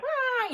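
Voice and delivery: high-pitched imitation scream at normal volume; sustained, hammed-up high "ah"; mock scream. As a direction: mimic someone freaking out with a high wail. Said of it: "them screaming and, you know, hooting and hollering".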